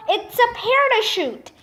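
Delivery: high, restrained yell. A narrator's reading. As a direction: call out the sighting with lifted pitch. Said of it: "yelled Stonewall Jackson".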